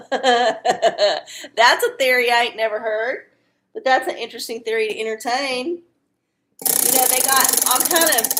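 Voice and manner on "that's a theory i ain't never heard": slow, laughing through the words. Real, not acted. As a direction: chuckle as you say it. Laughing at a question.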